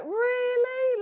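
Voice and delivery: very high, drawn-out incredulous question; mock disbelief. Medium retelling her reaction to his cheeky claim, mock disbelief. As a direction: shoot it way up as if you can't believe it. Acts out own sceptical reaction.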